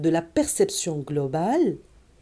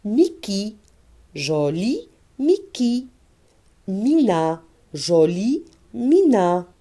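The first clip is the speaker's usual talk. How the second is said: slow reading demo; slower than usual. Shown as a demonstration. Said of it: "On passe à, à la phrase".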